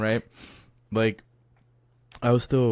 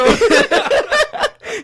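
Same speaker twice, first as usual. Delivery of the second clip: loud high cackling; loud, helpless high-pitched laugh; big burst of laughter; 10 dB louder than usual. Real, not acted. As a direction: explode laughing at the punchline. Everyone cracks up at punchline.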